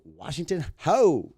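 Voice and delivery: high, booming, drawn-out name; re-enacted proud holler, stretched out; loud self-announcement. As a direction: proclaim your name slowly and loudly. Announcing his name to the star.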